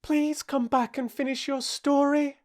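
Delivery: high-pitched entreating call; calling after. A narrator's reading. Alice calling after the departing mouse, entreating. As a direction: call out high to someone leaving. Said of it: "Alice called after it".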